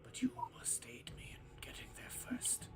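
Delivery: hushed, voiceless whisper; breathy whispered character voice; whispering captive character. Acted. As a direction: whisper an urgent plea for help. Game master voices the character.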